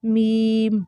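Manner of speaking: میم without nasal sound; clear meem. Shown as a demonstration. Teacher giving the correct میم. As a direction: say میم cleanly, nothing through the nose. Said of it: "ناک میں نہیں لے کر جانی".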